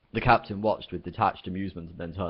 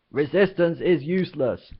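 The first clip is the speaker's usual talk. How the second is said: loud, higher bellowed slogan; 9 semitones higher, 7 dB louder than usual. Acted. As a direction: boom the drill phrase. Guard shouting again.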